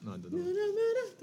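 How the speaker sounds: high silly voice. Pretend. Playful mock reply.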